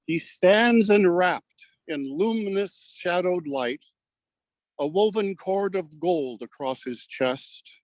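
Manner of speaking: slow, measured poem reading with long pauses; formal verse delivery, each phrase drawn out; poetry recital. Acted. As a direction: recite it line by line, unhurried and declaimed. Recites his winning poem aloud.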